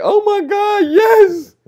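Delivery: very high, loud squealing mom; gushing mom impression. Son mimics mom approving of Jeffrey. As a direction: squeal it like an overjoyed mother. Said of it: "And now you like".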